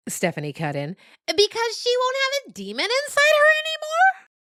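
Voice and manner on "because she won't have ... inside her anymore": put-on very high, bright kid's voice; a keen young goat, pitched far higher; piping little yearling voice; eager yearling goat.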